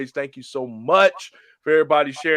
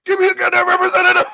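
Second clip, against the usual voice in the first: much higher, louder and fast; angry yell; 6 dB louder, faster than usual. Retold or imitated. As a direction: yell it at the phone. Caller yelling at phone system.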